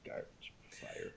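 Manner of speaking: two words breathed in a whisper; whispered verdict. Real, not acted. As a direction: whisper it like a secret judgement. Whispered verdicts after praise.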